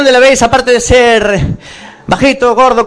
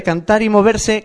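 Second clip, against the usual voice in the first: usual speaking voice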